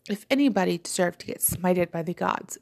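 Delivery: usual speaking voice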